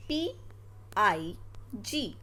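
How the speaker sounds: put-on name each letter slowly for the kids; slow spelling out. Shown as a demonstration. Teacher spelling a word for children, slowly. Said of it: "कैसे पढ़ा? Sound से".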